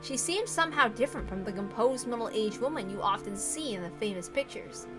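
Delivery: usual speaking voice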